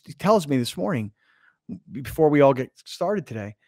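ordinary talk, nothing marked

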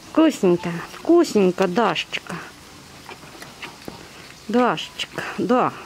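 low, soft cooing to an animal; tender cooing. Real, not acted. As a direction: coo gently at the bear while she eats. Cooing to the bear while feeding.

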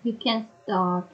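ordinary talk, nothing marked

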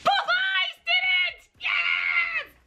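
shouting for joy over finished eyeliner; triumphant shout. Real, not acted. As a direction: cheer like you just won.

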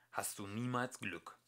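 plain reading voice

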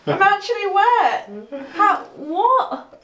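high-pitched, laughing disbelief; real shrieky surprise after an accidental soaking; shocked squeal. Real, not acted. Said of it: "Why are you screaming?".

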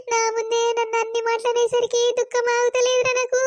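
tearful account building to a high wail; crying. Acted. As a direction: pour out the hurt while weeping. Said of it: "ఏడవకు, first కళ్ళు తుడుచుకో".

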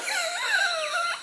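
high squeak of a laugh; squeaky laugh. Real, not acted. Butcher giggling at the punchline, high squeak.

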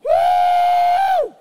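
very high, loud ecstatic whoop; Holy Ghost whoop. Real, not acted. Preacher letting off Holy Ghost steam, whooping. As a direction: let out a joyful shout of steam. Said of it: "a little bit of Holy Ghost steam".

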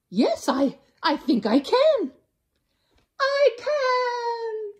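rising, growing confident little voice; brave koala. Acted. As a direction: find your courage. Kevin finally agrees.

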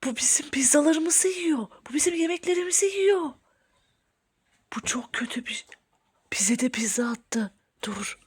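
very soft, secretive commentary; hushed whispering. Pretend. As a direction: murmur while spying from hiding. Spying secretly on the intruder.

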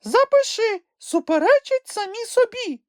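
shrieky small-creature accusation; high squeaky shout. A narrator's reading. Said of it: "крикнув Чмих".

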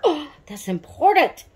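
oh, the name, I forgot the most important part; sudden realization. Real, not acted. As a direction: catch yourself, suddenly remembering. Suddenly remembers doll's name.

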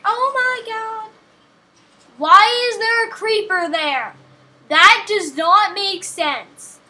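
high and loud, alarmed exclamations; retold startled shout. Miner retells spotting a creeper by diamonds, startled shout. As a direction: yelp it like a creeper just jumped you. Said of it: "and I was like".

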